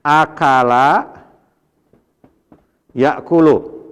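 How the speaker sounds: akala, yakulu, stretched very slowly syllable by syllable; slow demo. Shown as a demonstration. Teacher spelling out the verb forms to students, slow demonstration. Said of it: "asalnya kan akala nih".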